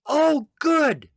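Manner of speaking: very high, loud call back; female voice calling. Acted. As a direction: holler it from down the hall. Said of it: "you hear a female voice say".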